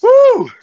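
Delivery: very high, loud, held "woo"; big workout whoop. Real, not acted. Instructor during the last rep, big whoop.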